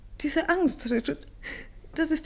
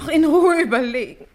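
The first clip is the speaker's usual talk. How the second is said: scoffing laugh, louder, throwing his words back; bitter laugh; 7 dB louder than usual. Acted. Catherine mocking Richard's calm suggestion with a bitter, louder laugh.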